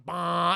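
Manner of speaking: loud drawn-out "bah"; truck horn imitation. Semi truck bearing down on heroes, imitated honk.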